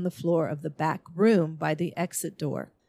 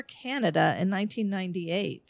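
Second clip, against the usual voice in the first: ordinary talk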